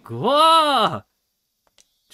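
long high loud "ぐわー" wail; hammed-up cry of defeat, stretched out; drawn-out mock scream. Muscle flattery knocks him over. (大げさに長く伸ばした叫び声)

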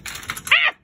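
sharp unvoiced yelp of pain; a sudden hurt cry. Real, not acted. Pet bites her finger.

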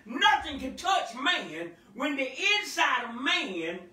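preaching. Acted. Pastor preaches to the congregation about the soul, fervent full-force preaching. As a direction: preach it out, building the fire.

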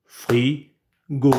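frigo split fri-go, slow and even; robot voice. Shown as a demonstration. (frigo haché en deux syllabes)

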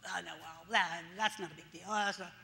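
soft, brushing it off; dismissive mutter. Retold or imitated. Someone downplaying their own sin, quietly. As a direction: mumble an excuse to yourself. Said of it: "maybe we go".

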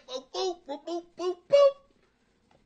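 high voiced boops bleeping out a secret; mock censor bleeps. Covering up what his sister knows with mock bleeps. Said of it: "Like I said".

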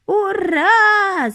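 high, loud, stretched cheer; whooping hurrah; shouted hurrah. A narrator's reading. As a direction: cheer at full pitch. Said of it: "закричав дідунь Джо".

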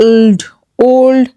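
ওল্ড drawn out slowly with a long ও; slow phonics demo. Shown as a demonstration. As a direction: stretch ওল্ড out for children. (ধীরে টেনে বলা ওল্ড)

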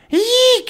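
very high squealed eek; high-pitched startled squeak; alarmed shriek. A narrator's reading. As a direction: squeal in horror like a mother bird. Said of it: "she cried".

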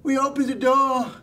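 sing-song plea to open the door; hammed-up hungry wolf begging.

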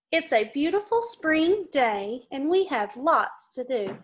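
lively storybook read-aloud; reading to children. Acted. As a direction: read it brightly for little kids. Reading picture book to kids.

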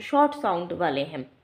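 normal talking voice

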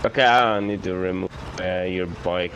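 ordinary talk

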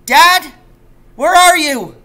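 loud, high desperate shout; panicked shout. A narrator's reading. Boy shouts into the night for his father, loud and panicked. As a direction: yell into the dark, desperate. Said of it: "I shouted into the darkness".

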